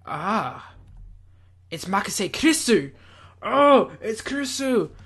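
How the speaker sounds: ordinary delivery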